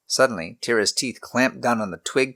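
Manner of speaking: ordinary delivery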